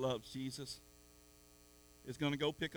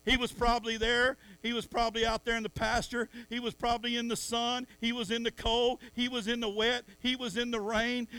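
higher, louder sermon cry; fiery preaching; 6 semitones higher, 7 dB louder than usual. Real, not acted. Preacher urging the church to be salty, high and loud. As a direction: build it up to the whole church.

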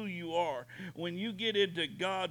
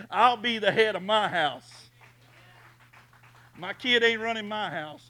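booming preaching; 5 semitones higher, 11 dB louder than usual. Acted. Preaching at full force.

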